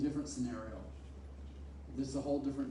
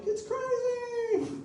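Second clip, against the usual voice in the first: bewildered; 5 dB louder, much slower than usual. Retold or imitated.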